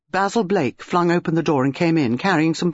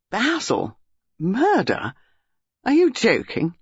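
higher-pitched, incredulous questions; 6 semitones higher, slower than usual. A narrator's reading. Dinah hears her husband may be arrested, incredulous. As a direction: question each thing in disbelief. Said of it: "she said incredulously".

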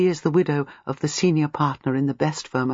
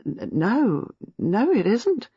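doubtful; 6 semitones higher, much slower than usual. A narrator's reading. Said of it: "She said doubtfully".

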